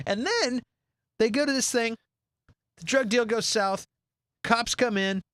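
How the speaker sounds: normal talking voice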